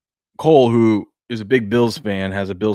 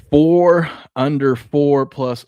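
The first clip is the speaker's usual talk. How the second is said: slow, higher deliberate read; slow spelling out; 6 semitones higher, 6 dB louder, much slower than usual. Shown as a demonstration. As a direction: read the handle word by word. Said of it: "reading handle aloud".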